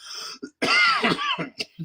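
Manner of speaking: harsh repeated cough; coughing fit. Real, not acted. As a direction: cough hard, then apologize. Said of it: "Sorry, I'm coughing a lot".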